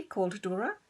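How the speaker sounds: plain reading voice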